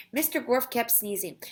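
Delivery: ordinary delivery, nothing marked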